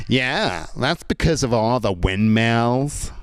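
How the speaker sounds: slow, stretched mock American; American tourist accent. Second American tourist explaining the wind, slow mock American accent. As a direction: explain it slowly as a clueless American. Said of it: "the other one went".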